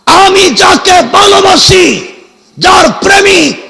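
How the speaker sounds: high, slow, drawn-out loud cry; impassioned preaching shout. Real, not acted. Preacher on the one he loves, impassioned shout. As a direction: belt each phrase out to the whole crowd. Preacher cries out about the beloved.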